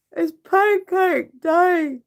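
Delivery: very high, slow, loud mock announcement; imitating partner's voice. Echoing partner's deadpan line in a silly high voice. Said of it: "this voice going".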